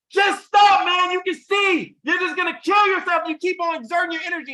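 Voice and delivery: very high, loud shouting into the pit. Retold or imitated. Friends scream down at the hopping frog, loud high yelling. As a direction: bellow at him to give up. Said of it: "the friends would look down and yell louder".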